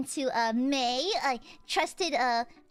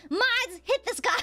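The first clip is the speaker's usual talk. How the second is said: loud; 10 semitones higher, 4 dB louder than usual. Acted. Mock command to mods.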